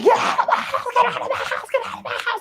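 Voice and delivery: high, frantic repeated screaming. Retold or imitated. Woman ordering man out of her house, screaming repeatedly. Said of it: "I'm just screaming".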